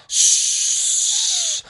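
shrill sucking drain noise; high whistling whoosh. Acted. The drain swallowing everything, whooshing whistle.

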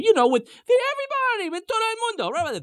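high-pitched, sweeping exuberance; exuberant. Retold or imitated. Moshe announcing everybody is going, exuberant. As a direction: sing it out high, taking everyone in.

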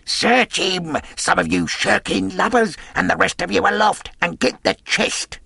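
high, urgent commanding shout; impatient gang leader yelling instructions; cried orders. A narrator's reading. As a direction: bark orders at shirking sailors. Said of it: "he cried".

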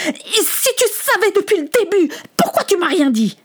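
high, worked-up angry demand; heated reproach. Acted. Samuel threatens his father with a finger, heated reproach. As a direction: raise the pitch sharply in accusation. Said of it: "La conversation monta encore d'un ton".

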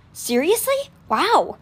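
taken aback, then impressed; surprised. A narrator's reading. Boy surprised by the girl's book, startled. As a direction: voice jumps up on seriously.

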